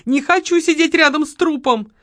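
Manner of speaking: high-pitched stubborn protest; balking refusal. A narrator's reading. Lola won't sit beside a corpse, whining stubbornly. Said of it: "заортачилась Лола".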